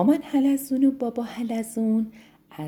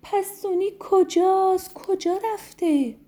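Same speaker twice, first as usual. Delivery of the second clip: worried snails all together; 11 semitones higher, 3 dB louder than usual. Acted. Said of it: "همه با هم گفتن".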